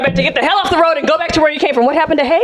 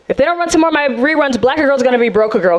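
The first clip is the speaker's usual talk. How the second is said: ordinary talk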